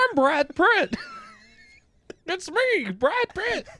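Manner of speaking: hammed-up Nixon-voiced Brad Pitt; Richard Nixon voice. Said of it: "Jonah Hill has the voice of Richard Nixon".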